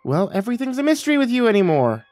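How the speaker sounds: high, huffy motherly retort; indignant mom on the defensive; shot back. Acted. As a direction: throw it back accusingly. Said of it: "she shot back".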